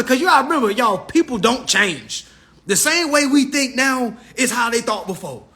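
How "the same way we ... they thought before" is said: usual speaking voice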